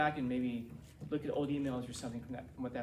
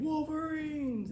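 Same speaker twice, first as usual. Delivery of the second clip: much higher, long drawn-out victory yell; battle cry; 13 semitones higher, much slower than usual. Acted. High school students winning a battle, rifles up, battle cry. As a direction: holler it like a triumphant war cry.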